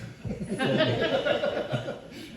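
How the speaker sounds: laugh. Real, not acted. Laughing at own joke.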